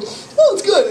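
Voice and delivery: high, laughing mock of a casual coffee drinker; breezy high voice as if hot coffee is nothing. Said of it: "but then you just go".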